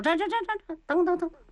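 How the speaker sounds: high, hurried stop-stop-stop; hastily waving him back; urgent calling back. Acted. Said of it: "当时一摆手".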